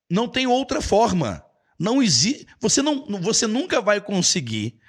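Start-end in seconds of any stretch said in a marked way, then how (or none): none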